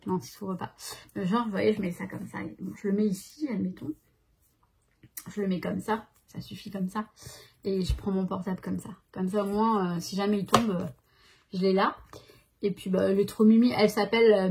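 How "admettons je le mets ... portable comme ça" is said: normal talking voice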